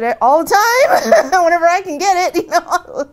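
high loud laughing reply. Retold or imitated. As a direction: laugh through it, amused.

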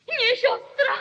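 NIE thrown out high and loud; shouted denial over the bloodstain; horrified cry. Acted. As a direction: recoil from the word sister. Murderer sees blood.

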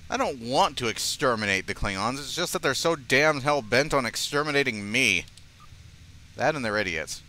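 normal talking voice

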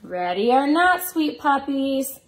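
slow, sing-song hide and seek call; reading to children. Acted. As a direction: call it slowly, playful and sweet.

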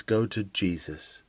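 ordinary delivery

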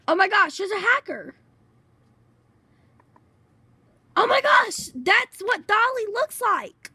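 thrilled gamer finding the hackers; excited exclaiming. Real, not acted. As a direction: whoop it up at the discovery.

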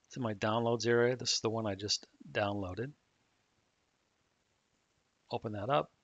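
ordinary delivery

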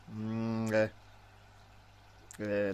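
usual speaking voice

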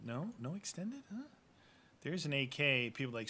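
ordinary talk

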